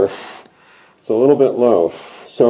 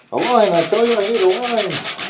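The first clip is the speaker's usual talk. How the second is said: high, theatrical mock plea; dramatic plea; 9 semitones higher than usual. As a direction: ham it up like a desperate hero.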